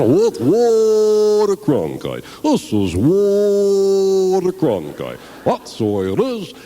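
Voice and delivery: slow, booming TV anchor sign-off; Walter Cronkite impression. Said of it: "That fucking Walter Cronkite".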